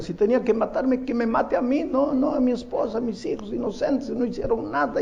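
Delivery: high, strained cry of grief and rage; retold anguished yelling. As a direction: shout it at God in despair. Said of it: "Y ahí en la puerta gritaba".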